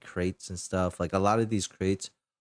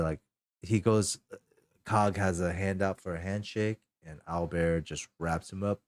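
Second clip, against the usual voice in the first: ordinary talk, nothing marked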